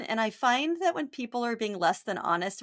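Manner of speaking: normal talking voice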